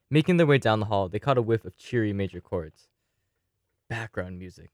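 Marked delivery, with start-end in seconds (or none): 3.9-4.7 low, much softer contemptuous spit of words; bitter under-the-breath disgust; spat. Acted. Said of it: "Kev spat".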